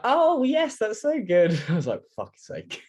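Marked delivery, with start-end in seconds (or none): 0.0-1.6 very high, gushing mum voice; mock delight, pitched way up; imitated delighted mum. Voicing mum finally getting the joke. 2.1-2.8 retold under-the-breath curse; much softer, voiceless grumble; muttered exasperation.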